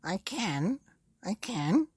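put-on high, excited old woman's voice; high-pitched Mrs Twit, thrilled by the pull; Mrs Twit crying out. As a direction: squeal it out as the strung-up wife. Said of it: "cried Missus Twit".

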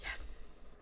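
voiceless, hissed yes; hushed celebration; whispered triumph. Real, not acted. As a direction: whisper a private victory. Quiet win in game.

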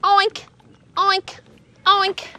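high playful oink, oink, oink; pig oinking. Retold or imitated. Telling the kids what a pig says, pig oinks. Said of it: "A pig says".

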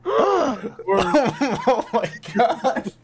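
high laughing oh my God; laughing disbelief. Real, not acted. GM cracking up at the diner joke, laughing.

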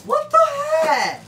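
very high, loud cry of disbelief; startled outburst. Real, not acted. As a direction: blurt it out loudly, startled. Startled by the launch failing.

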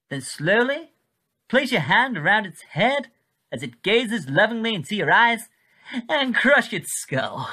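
put-on clipped old English newsreel voice; a wartime Pathé announcer coaxing a viewer into cruelty; 1940s newsreader. A newsreel voice egging the viewer on, in clipped 1940s tones. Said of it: "the clipped tones of a nineteen forties English newsreader".

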